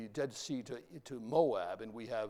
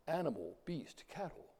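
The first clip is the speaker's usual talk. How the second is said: ordinary delivery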